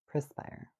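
Perspire whispered pres-pire; whispered wrong way. Shown as a demonstration. Host whispering the wrong pres-pire. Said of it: "Instead of perspire".

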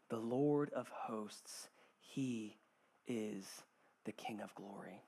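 very slow, low and soft, with pauses; hushed scripture reading. Acted. Preacher closing the psalm for the congregation, hushed and reverent. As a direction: drop low and quiet, each word set apart in reverence.

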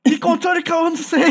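high, shrill-free baffled question in another voice; baffled mother. Retold or imitated. Woken mother asks her sleepwalking son, baffled. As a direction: play the woken mother, confused.

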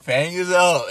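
loud, high hype voice; excited exclaim. Retold or imitated. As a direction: yell it like a hyped fan. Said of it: "I was like".

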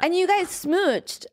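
slow, high teasing tone. Real, not acted. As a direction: drag it out playfully. Teasing friend about kiss.

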